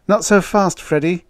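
high, loud interjection; checking a colleague. A narrator's reading. Officer halting his colleague's advice, high and admonishing. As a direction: cut in high and forcefully.

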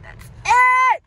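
high, loud exclamation; abrupt high-pitched shout; sudden yell. Real, not acted. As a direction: blurt it out at full volume. Playful emphatic end to money report.

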